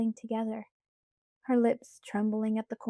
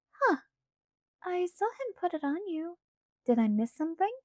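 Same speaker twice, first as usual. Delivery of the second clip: put-on light, higher girlish pleading voice; Alice's voice; 7 semitones higher than usual. A narrator's reading. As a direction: voice Alice higher, coaxing Bella. Alice puzzled.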